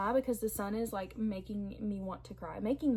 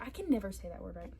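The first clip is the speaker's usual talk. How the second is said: usual speaking voice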